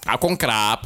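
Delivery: drawn-out mock Thai accent; lightly hammed-up Thai phrase; mock Thai man. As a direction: imitate a Thai man saying thanks. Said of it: "thailändsk man som".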